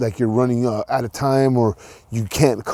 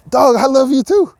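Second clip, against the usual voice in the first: excited love you too; 12 semitones higher than usual. Retold or imitated. Answering the man's thanks.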